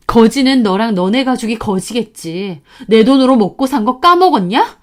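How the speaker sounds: angry retort. Retold or imitated. Wife retorts on phone.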